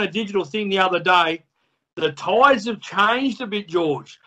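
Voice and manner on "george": usual speaking voice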